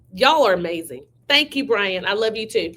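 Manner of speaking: ordinary talk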